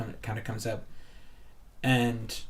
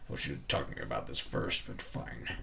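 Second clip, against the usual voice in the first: much lower and softer, reluctant; grudging low grumble; 9 semitones lower, 7 dB quieter than usual. Acted. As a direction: give in grudgingly under your breath. Belnir grudgingly agrees.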